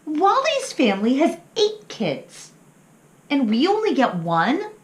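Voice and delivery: put-on high, eager child voice; little pup voice. Little pup asking his mama, high and curious.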